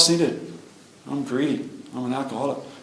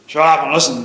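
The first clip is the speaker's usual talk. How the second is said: big voice; 7 dB louder than usual. Retold or imitated. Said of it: "he's got this real big ... voice".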